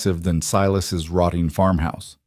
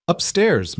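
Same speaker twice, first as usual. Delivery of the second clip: put-on light Chinese accent, higher, brisk call; lightly voiced housekeeper announcing; announced with accent; 9 semitones higher than usual. A narrator's reading. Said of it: "Missus Chen announced".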